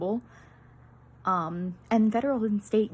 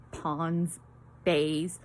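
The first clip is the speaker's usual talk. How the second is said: usual speaking voice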